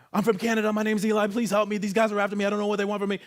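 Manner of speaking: high, loud, desperate plea for help; panicked pleading. Retold or imitated. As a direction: beg strangers for help, panicking.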